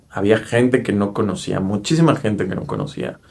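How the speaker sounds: ordinary delivery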